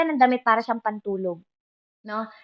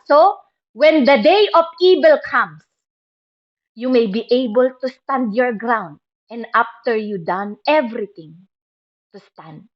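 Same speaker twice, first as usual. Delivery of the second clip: slow, loud verse proclaimed from the pulpit; scripture proclamation; 6 dB louder, slower than usual. Acted. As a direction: ring out each word of the verse. Proclaims Ephesians verse in English.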